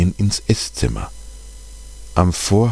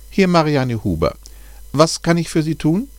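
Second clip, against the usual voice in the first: friendly expert; 10 semitones higher than usual. A narrator's reading. Narrator voices the bat expert.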